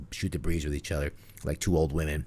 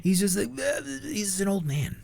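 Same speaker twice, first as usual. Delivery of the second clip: put-on old man voice; 12 semitones higher than usual. Mocking [name] as an old man.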